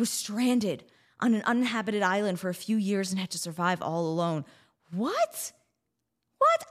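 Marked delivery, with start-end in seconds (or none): 4.9-6.6 high, rising, incredulous question; amazed disbelief. Real, not acted. Host learns a sailor survived alone, amazed disbelief. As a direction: squeak it out, astonished.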